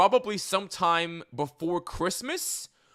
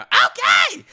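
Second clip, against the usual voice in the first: very high, loud screech; screechy voice; 9 dB louder than usual. Retold or imitated. Fan imitating the voice he expected from the actor, screechy. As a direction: shriek it in a cartoonish screechy voice. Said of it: "like, the screechy voice".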